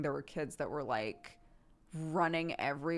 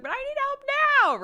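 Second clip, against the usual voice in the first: high, louder whiny demand; mock little-kid tantrum, pitched way up; imitated kid yelling; 10 dB louder, much faster than usual. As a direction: mimic a child's impatient yell. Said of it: "stop yelling at me".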